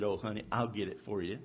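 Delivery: a wife promising to fix it; soothing wife. Retold or imitated. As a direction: comfort him like a pouting child. Jezebel soothes her sulking husband.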